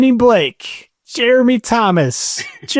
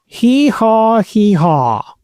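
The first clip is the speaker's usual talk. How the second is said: donkey bray; slower than usual. Pretend. Donkey call on request.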